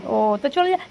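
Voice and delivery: ordinary talk, nothing marked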